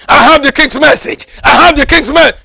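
loud, high, proud announcement; a poor man demanding entry with the king's word; bold proclaiming messenger. Acted. Cockney proclaims at each gate.

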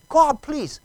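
high, mildly desperate retold plea; retold crying plea. Preacher retelling his plea to God in the woods, pleading. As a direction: plead upward as if imitating your crying. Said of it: "And I was crying. I asked God".

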